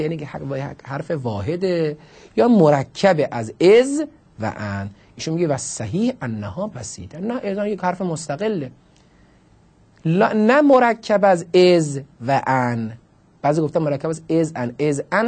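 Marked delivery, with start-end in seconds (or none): 2.4-4.9 از and ان drawn out slowly, each part separate; slow spelled-out parts. Shown as a demonstration. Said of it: "teacher spells out components". 10.0-12.9 مرکب stressed, whole phrase slowed; slow emphatic denial. Shown as a demonstration. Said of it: "teacher rejects compound view".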